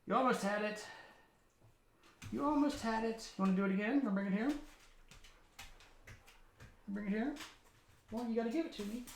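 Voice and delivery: high, encouraging pet-talk voice; puppy talk. Real, not acted. Owner playing catch with his puppy, high coaxing voice. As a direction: encourage a puppy, pitched well up.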